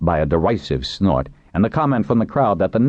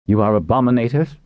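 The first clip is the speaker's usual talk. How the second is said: controlled question, pitched up; oddly controlled; 7 semitones higher than usual. A narrator's reading. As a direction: ask it with restraint, rising at the end. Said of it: "in an oddly controlled voice".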